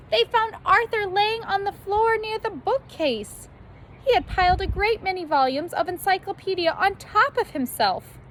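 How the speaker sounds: high, lively storybook narration; bright read-aloud narration; animated narration. A narrator's reading. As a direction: read it like an excited bedtime story. Narration lifted, far timbre.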